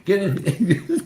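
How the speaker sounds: slow, amused push in; laughing, high and drawn out; mocking shove. Mocking the site's approach.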